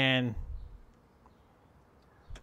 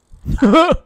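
high burst of laughter; 9 dB louder than usual. Real, not acted. Laughs at game failure.

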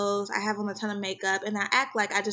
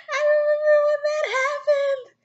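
laughing; 6 dB louder than usual. Retold or imitated. Recalling past, half laughing.

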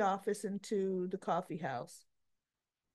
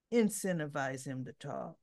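lower, mock mob-boss command; a hint of a gangster giving orders; 7 semitones lower than usual. [name] hands Jason zip ties, lightly mocking a mob-boss order. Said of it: "like she's Sonny giving orders".

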